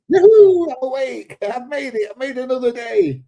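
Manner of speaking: crowing with delight at being alive; joyful yoo-hoo. Retold or imitated. Old man greeting each new morning, gleeful and high.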